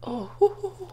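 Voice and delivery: real tears breaking through, voice catching; choking up and trying to breathe through it; crying. Real, not acted. Remembering her little sister, breaking down crying. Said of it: "Oh my God, I'm crying".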